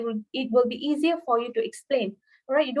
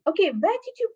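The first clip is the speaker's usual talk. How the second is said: casual fluent question; 5 semitones higher than usual. Shown as a demonstration. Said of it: "let's say I, I say".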